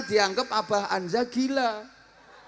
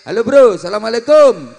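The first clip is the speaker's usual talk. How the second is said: loud, high and breezy greeting to a mate; casual friend greeting; 5 semitones higher, 11 dB louder than usual. Shown as a demonstration. Greeting a friend met in the street, loud and casual. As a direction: shout it like bumping into a friend. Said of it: "nggak kayak M C".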